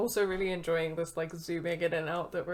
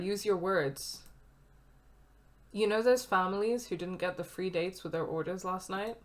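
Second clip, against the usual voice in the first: ordinary talk, nothing marked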